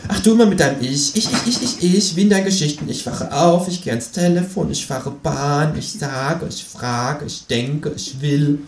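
mock the self-obsessed writer; mocking Känguru. (spöttisches Känguru, höher)

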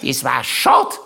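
high put-on aunt voice; Tante Mari. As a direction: voice the aunt's regret, pitched high. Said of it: "weil sie es aber allen noch einmal gesagt hat".

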